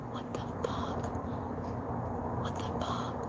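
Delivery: a hushed, breathless whisper of disbelief; stunned whisper. Retold or imitated. Tommy off to the side of the FaceTime, shocked whisper. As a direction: whisper it so the caller can't hear.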